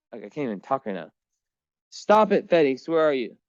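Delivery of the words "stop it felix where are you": high, loud sharp call; calling the cat. Real, not acted. Owner hunting for cat Felix, loud high call. Chasing cat around apartment.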